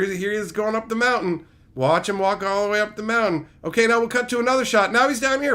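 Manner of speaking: usual speaking voice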